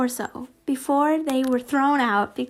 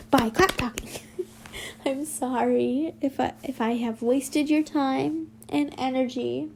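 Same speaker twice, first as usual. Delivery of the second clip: ordinary delivery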